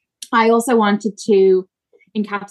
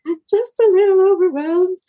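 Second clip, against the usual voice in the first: small overwhelmed voice; 10 semitones higher, 4 dB louder than usual. Retold or imitated. Replying to midwife, overwhelmed.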